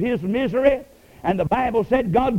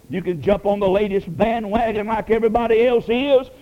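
normal talking voice

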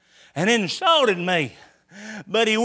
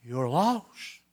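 low and much softer, close to a whisper; hushed voice of grace; 9 semitones lower, 8 dB quieter than usual. Retold or imitated. Grace whispering to the lost man in church, hushed. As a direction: murmur it right into his ear. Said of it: "kept on whispering in my ear".

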